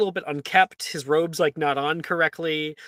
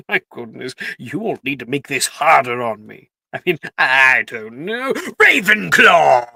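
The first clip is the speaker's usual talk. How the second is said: Sorting Hat voice; 8 dB louder than usual. Acted. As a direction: hum it over, then boom out the house.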